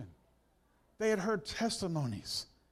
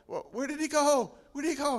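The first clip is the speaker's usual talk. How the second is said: frantic; 6 semitones higher, faster than usual. Acted. Blind man frantic in acted scene.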